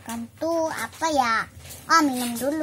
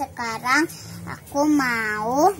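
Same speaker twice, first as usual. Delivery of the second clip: ordinary talk, nothing marked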